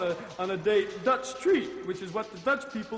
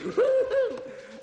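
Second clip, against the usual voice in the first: high cackle; 4 dB louder than usual. Acted.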